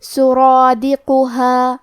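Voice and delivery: سرادقها very slow, را and long alif full and heavy; heavy recitation. Shown as a demonstration. Teacher demonstrating سرادقها, slow heavy chant. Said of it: "موٹی آواز میں پڑھنا ہے".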